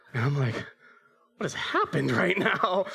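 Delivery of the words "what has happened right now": high, drawn-out disbelief; slow, high-pitched "what on earth" reaction; baffled alarm. Retold or imitated. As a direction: retell a dad's stunned discovery. Dad discovers broken cabinet door.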